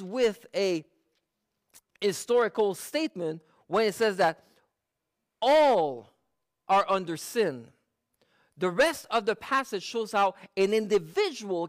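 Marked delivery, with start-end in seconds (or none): 5.4-6.1 "all" punched much louder; stress on all. Shown as a demonstration. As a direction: hit "all" hard and alone. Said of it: "I emphasize certain words".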